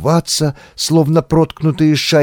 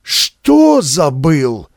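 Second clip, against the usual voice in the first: shocked; much slower than usual. A narrator's reading. Shocked Bulgarian demands.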